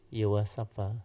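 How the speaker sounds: usual speaking voice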